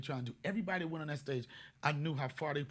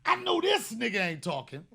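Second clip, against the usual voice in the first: mock indignation; 7 semitones higher, 9 dB louder than usual. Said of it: "Here's another one".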